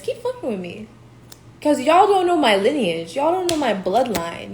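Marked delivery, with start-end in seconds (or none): 0.0-0.9 taunting dare. Retold or imitated. Telling her enemies to keep messing with her, quiet taunt. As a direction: dare them quietly, almost to yourself. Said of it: "I keep telling these motherfuckers".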